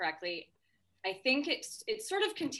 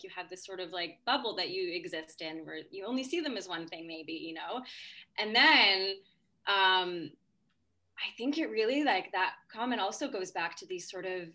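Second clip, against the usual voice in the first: ordinary talk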